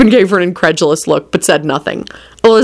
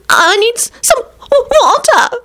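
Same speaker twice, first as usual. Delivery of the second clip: very high, drawn-out whine; irritable; slower than usual. A narrator's reading. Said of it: "Loretta replied irritably".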